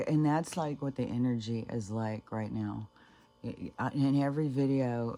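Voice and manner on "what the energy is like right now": ordinary talk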